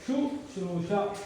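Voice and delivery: शुश्रूषा said slowly, each syllable apart; slow careful model word; correct pronunciation. Shown as a demonstration. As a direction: pronounce शुश्रूषा deliberately like a teacher. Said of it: "इस प्रकार से लिखा जाना चाहिए".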